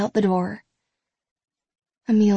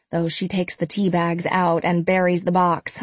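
ordinary delivery, nothing marked

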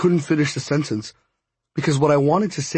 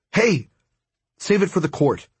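firm call; 5 semitones higher than usual. Acted. Holding Hondo back after lunge.

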